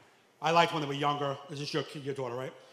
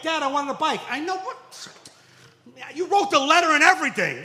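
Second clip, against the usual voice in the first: put-on much higher, louder whiny kid; kid complaint; 10 semitones higher, 8 dB louder than usual. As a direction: whine it like a disappointed boy. Kid and dad voiced.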